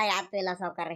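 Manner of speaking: timid servant brushing off the master's question; mumbled denial. Acted.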